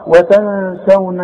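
"وتنسون" slow, nasal hum held two beats; two-count ghunna. Shown as a demonstration. Teacher models for pupil, two-count nasal hum. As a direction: hold the hidden n in "وتنسون". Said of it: "والغنة بمقدار حركتين".

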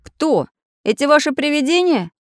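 high, insistent questioning; probing. A narrator's reading. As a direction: press them for an answer. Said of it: "допытывался Самоделкин".

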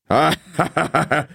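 sneering villain cackle; hammy evil laugh; gloating laugh. A narrator's reading. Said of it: "злорадно засмеялся Ингвар".

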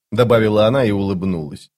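ordinary delivery, nothing marked

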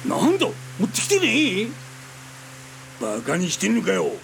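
loud, high snarl of a furious extortionist; angry thug. Acted. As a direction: bark at her for showing up empty-handed. 金を持ってこない女に凄む男. (怒鳴りつけるチンピラ)

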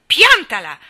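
furious loud shout; girl screaming at her cousin to stop. A narrator's reading. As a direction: yell it to stop him. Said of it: "urlò forte".

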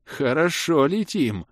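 slow, high, blissful remark; happy murmur. A narrator's reading. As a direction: savour the flight happily, drawing it out. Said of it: "счастливо пробормотал Шёпот".

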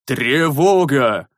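slow, fuller alarm cry. A narrator's reading. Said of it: "Уже куда осмысленнее и громче крикнул".

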